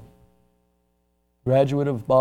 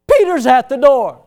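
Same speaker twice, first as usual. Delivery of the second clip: high, loud excited cry; excited announcement; 10 semitones higher, 11 dB louder than usual. Acted. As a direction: burst out with the news. Damsel runs back excited.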